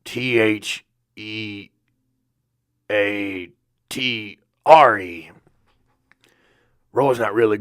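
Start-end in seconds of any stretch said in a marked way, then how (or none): 0.1-5.3 letter by letter, slow and careful; spelled out slowly. Shown as a demonstration. Roy spelling theatre for listeners, slow letters. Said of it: "theater is spelled".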